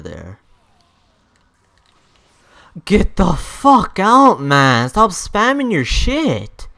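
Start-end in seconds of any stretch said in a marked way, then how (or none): 2.8-6.7 raised, drawn-out exasperation; angry yell. Real, not acted. Player telling a spammer to stop, angry yell. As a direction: yell it slowly at the opponent.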